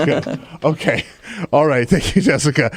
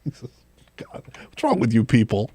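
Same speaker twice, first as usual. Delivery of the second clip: whispered in disbelief; exasperated whisper; 5 semitones lower, 5 dB quieter than usual. Real, not acted. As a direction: breathe it out under your breath, fed up. Host exasperated by crass caller.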